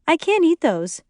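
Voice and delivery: lightly voiced dieting mother; Lori's quick refusal. A narrator's reading. Lori curtly refuses the brownies.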